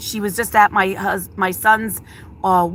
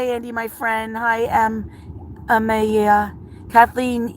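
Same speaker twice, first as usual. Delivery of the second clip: normal talking voice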